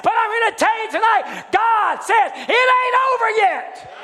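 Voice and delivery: high, loud revival shout, building; full-force preaching. Real, not acted. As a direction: hammer each phrase to the congregation.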